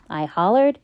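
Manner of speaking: ordinary delivery, nothing marked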